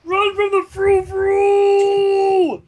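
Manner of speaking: high, loud, slow shout; mock panic. Player warning the others in jest: high slow panicked shout. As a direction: howl it like fleeing a monster. Players riff on frou-frou joke.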